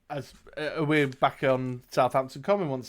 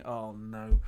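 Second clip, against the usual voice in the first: normal talking voice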